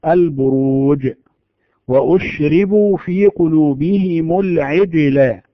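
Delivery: البروج and العجل slow, jeem stopped hard; correct stopped jeem. Shown as a demonstration. Teacher reciting verses with a firm stopped jeem. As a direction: land firmly on each jeem. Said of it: "فنقول".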